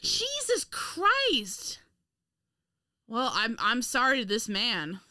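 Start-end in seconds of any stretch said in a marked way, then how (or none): 0.0-1.8 high, drawn-out exclamation of disbelief; real appalled outburst; shocked exclamation. Real, not acted. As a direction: stretch it out, pitched way up. Shocked to learn he was Irish.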